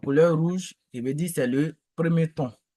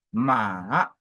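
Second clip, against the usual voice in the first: "ma" dipping low, then rising; long low dip on "ma"; third tone; 5 semitones lower than usual. Shown as a demonstration. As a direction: sink on "ma", then swing it upward. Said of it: "le troisième ton".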